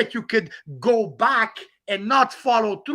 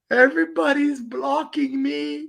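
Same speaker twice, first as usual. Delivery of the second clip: mock crying; 4 semitones higher, 4 dB louder than usual.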